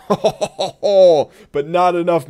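slow, loud, drawn out through laughter; gloating laugh. Real, not acted. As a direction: savour the win, laughing. Laughs after surviving damage.

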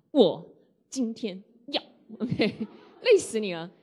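laughing at own overdone stress; mock effortful. Shown as a demonstration. Teacher shows how tiring constant stress is, laughing. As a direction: strain the words, then break into a laugh. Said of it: "讲话是很累的".

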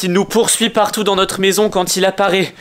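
usual speaking voice

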